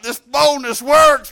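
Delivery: slow, loud exclamation; shouting boldness; bold shout. Retold or imitated. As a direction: proclaim it boldly. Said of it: "I'm like".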